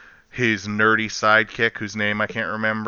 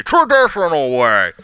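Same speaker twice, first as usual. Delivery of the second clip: louder put-on stubborn voice; mock traditionalist; 3 semitones higher, 5 dB louder than usual. Builder rejecting better technology, louder mock stubborn voice. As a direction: imitate a stubborn traditionalist cutting in. Repeats the mocked traditionalist line.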